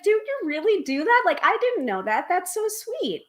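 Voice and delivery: high-pitched retold surprise; delighted surprise. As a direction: react sweetly surprised, voice jumping up high. Retells her delighted surprised reaction.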